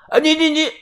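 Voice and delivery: high-pitched, sputtering protest; flustered stammer. Acted. Debtor hearing the shop will be raided, flustered stammer. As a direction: stutter helplessly on one word.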